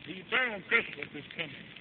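normal talking voice

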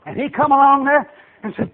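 ordinary talk

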